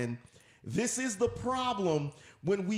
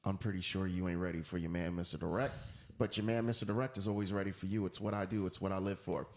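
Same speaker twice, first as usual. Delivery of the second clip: much lower, softened self-promo voice; deeper voice; 10 semitones lower, 4 dB quieter than usual. Real, not acted. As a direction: drop deep for the signature catchphrase. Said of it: "why does my voice get deeper".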